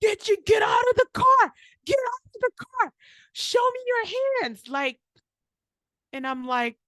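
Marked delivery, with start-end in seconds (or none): 0.0-4.7 barked police commands, much higher and louder; shouting cop. Retold or imitated. Cop yelling at a frozen driver to get out. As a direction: yell it like a cop at a traffic stop. Cops screaming at her in car.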